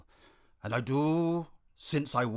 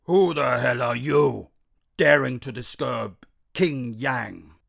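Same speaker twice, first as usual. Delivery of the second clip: slow, loud lion growl; threatening growl; 6 dB louder, slower than usual. Acted. Lion king growling at the owl on the cupboard, loud and slow. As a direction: menace the intruder from below. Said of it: "He let out a threatening growl".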